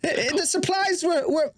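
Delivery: very high, frightened, stammering voice; pleading Hermie. Acted. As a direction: squeal out halting excuses after being hit. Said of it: "Hermie presumably".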